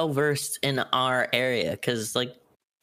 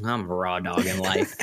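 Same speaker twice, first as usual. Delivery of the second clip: deadpan; 7 semitones lower than usual. Retold or imitated. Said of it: "I dead ass was like".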